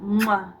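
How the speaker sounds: loud "mwah" kiss; kiss noise. Pretend. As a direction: blow an audible goodbye kiss. Blows kiss ending a call.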